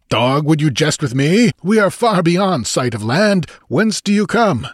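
high-pitched, indignant challenge; angry. A narrator's reading. Master accuses the stranger of jesting, angrily. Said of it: "exclaimed the master angrily".

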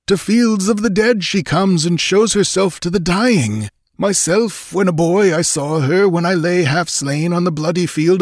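put-on high, fervent old seer's voice; wild old man. A narrator's reading. Older man tells warriors of the frost giant's daughter, wildly fervent. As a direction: tell it in a high, haunted cry. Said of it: "cried an older man, whose eyes were wild and weird".

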